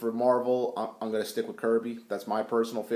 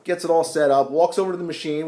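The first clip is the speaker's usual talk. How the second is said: ordinary delivery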